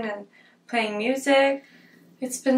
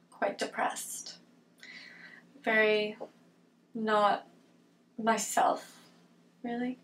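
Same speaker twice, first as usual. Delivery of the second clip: soft, subdued and halting; real low mood; 8 dB quieter, slower than usual. Real, not acted. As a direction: let the words sink, quiet and downcast. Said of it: "I've just been feeling".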